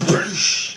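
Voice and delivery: voiceless spat-out clash noise; imitated crash. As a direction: mimic two armies colliding. Imitates armies crashing together.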